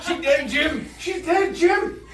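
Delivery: high, loud hammed-up cry; mock-dramatic shout. Player announcing a death to Jim, mock-dramatic shout. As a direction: yell the catchphrase through laughter. Joking film catchphrase, yelled, laughing.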